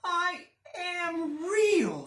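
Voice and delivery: high, slow protest; indignant little rabbit. Acted. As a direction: insist hurt but firm.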